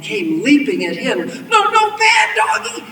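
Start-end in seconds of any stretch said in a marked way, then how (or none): 1.5-2.8 very high, loud yelp; panicked shout. A narrator's reading. Villain shouting at leaping wolves, panicked. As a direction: shriek a scolding in panic.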